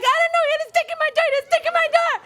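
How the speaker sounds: high, loud frantic pleading; panicked call. Retold or imitated. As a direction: blurt it to the dispatcher in panic. Panicked nine one one call.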